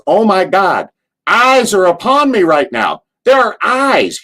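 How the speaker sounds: high, loud Southern panic; Cornette impression. Cornette mooned during his promo, high panicked Southern voice. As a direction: shriek it like Cornette mid-promo. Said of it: "Cornette goes".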